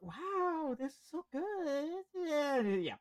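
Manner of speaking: high, soft, drawn-out swooning; mock fan rapture. Teasing Arctic Monkeys fans to a friend, mock rapture. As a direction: swoon quietly in mock awe. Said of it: "they'll just be like".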